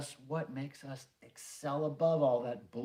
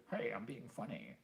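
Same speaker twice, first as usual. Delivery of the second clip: softer, mocking a try-hard comic; lightly mock someone fishing for laughs, softer; mock jokey voice; 4 semitones lower, 10 dB quieter than usual. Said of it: "Devo wasn't a".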